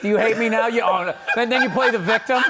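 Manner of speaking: loud, high martyr's rant; over-the-top marital whine; mock whiny spouse. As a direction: play the aggrieved victim at full pitch. Acting out a whining spouse quarrel.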